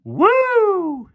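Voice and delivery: high, loud sarcastic woo; mock cheer. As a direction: whoop like a fan, but mocking.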